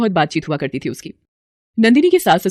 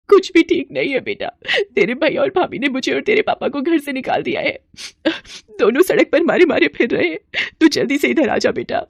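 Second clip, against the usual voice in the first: crying; 7 semitones higher than usual. Acted. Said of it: "वो रोते हुए बोली".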